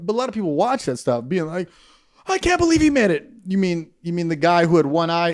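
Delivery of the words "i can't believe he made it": high, amazed mock viewer; much higher mock disbelief; gullible viewer. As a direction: play the naive fan, wide-eyed.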